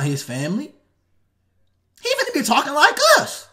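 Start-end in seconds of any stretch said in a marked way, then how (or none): 2.0-3.5 higher, louder imitation of slang; playful high slang imitation; mock slang voice.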